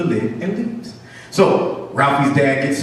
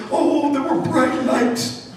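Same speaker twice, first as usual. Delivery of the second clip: slow, high mock-horror voice; old Mouse Johnson; 11 semitones higher, much slower than usual. Old mouse answering the curious mice, slow high dread. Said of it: "Like old Mouse Johnson".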